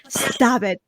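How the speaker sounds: ordinary talk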